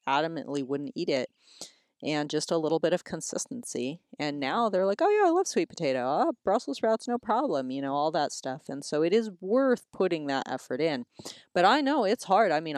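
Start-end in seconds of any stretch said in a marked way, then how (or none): 5.0-7.7 high, quick imitation of keen kids; easygoing eager kids. Kids who now love their vegetables, mock eagerness. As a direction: pitch it up like an agreeable child.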